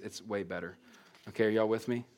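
ordinary talk

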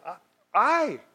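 "I" isolated and pitched high; single out the word "I" with a high swoop; drawn-out, exaggerated "I"; stress on I. Shown as a demonstration. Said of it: "get the emphasis on the right syllable".

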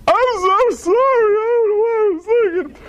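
put-on high, teary child voice; child near tears saying sorry to dad; whimpering apology. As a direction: plead sorry like a scolded kid.

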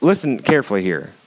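ordinary talk, nothing marked

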